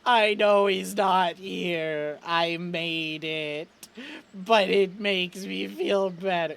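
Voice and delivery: slow, drawn-out sad character voice; grieving admission. Acted. Chet admits he made the skull, slowly and sadly. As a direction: confess it slowly, holding back tears.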